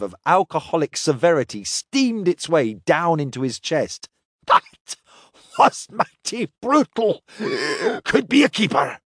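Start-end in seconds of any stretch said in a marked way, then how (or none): 4.5-9.0 strained, high gasp after a fiery shot; choked by strong drink. Acted. Drinker reacts as the liquor hits his chest, choked.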